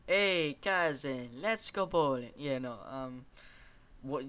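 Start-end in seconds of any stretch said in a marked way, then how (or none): 0.1-2.3 loud, higher-pitched caricature voice; hammed-up game character invitation; cousin catchphrase. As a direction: call it out like a pestering cousin. Impression of a game's cousin catchphrase.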